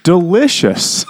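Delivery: high, drawn-out "delicious"; mock husband pleading. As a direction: stretch out "delicious" slowly and high, coaxing. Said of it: "He says".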